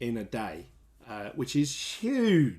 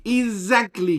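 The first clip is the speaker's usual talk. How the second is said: "exactly" repeated much higher and louder; excited repeat; 9 semitones higher, 8 dB louder than usual. Real, not acted. Vlogger repeating to viewers, "exactly" high and loud. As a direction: hammer "exactly" once more.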